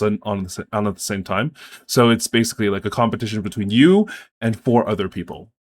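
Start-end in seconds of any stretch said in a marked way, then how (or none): none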